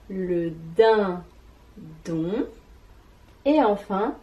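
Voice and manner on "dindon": "dindon" as din... don, rising at the end; very slow "dindon" in two beats; long pause inside "dindon", lifting "don"; split into syllables. Shown as a demonstration. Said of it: "des syllabes qui composent".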